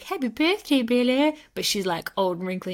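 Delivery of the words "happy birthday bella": high, sing-out birthday greeting; Edward. Retold or imitated. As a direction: put on a raised, exaggerated greeting voice. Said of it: "And Edward being like".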